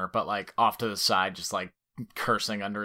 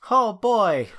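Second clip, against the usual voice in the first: put-on wholesome 1950s kid; 11 semitones higher, 7 dB louder than usual. Gee-whiz reaction to initiative.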